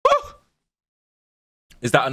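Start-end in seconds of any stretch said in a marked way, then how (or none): 0.0-0.3 excited woo. Real, not acted. Reacts to match.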